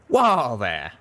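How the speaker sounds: loud cowboy whoa; exclaiming. A narrator's reading. Calamity catching the fainting mare, exclaiming. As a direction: call out as he catches her. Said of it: "Calamity exclaimed".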